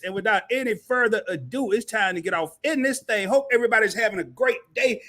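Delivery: big, loud live-stream opener; announcer energy to kick off the stream; show host hype intro. Acted. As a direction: open the show like a hype man.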